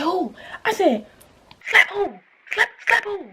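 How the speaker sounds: a disbelieving dare, pitch shooting up; incredulous challenge. Retold or imitated. Retold standoff with friend, challenged.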